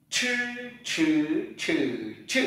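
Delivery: "Chư" with curled tongue and puff of air, four tones; aspirated retroflex ch. Shown as a demonstration. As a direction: curl and burst air on each "chư". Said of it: "chúng ta làm lại lần nữa". (đọc "chư" cong lưỡi, bật hơi)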